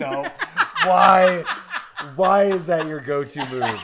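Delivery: loud, high question through laughter; laughing protest. Real, not acted. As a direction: ask it incredulously while cracking up. Laughing at the boy voice.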